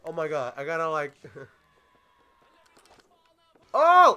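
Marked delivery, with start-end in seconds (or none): 3.8-4.2 surprised yelp. Real, not acted. Knee to the face hit.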